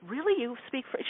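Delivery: high, hushed disbelief; soft, incredulous question; retold startled. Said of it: "I was startled".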